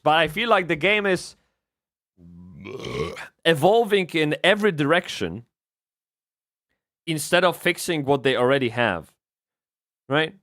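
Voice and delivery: usual speaking voice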